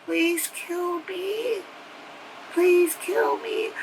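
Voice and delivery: high, soft, slow begging; desperate plea. Retold or imitated. Trapped girl begs quietly to be killed. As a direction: beg faintly, in despair. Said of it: "her actual self, and she's like".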